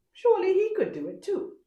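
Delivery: the envious leopard convincing himself; leopard thinking. A narrator's reading.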